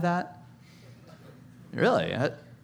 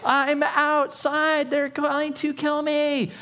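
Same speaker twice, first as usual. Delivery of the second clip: very high, slow, drawn-out pleading wail; desperate Peter; slower than usual. Retold or imitated. Peter left outside begging the servant girl, slow high wail. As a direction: stretch each word out in a high desperate whine. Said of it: "and he's like".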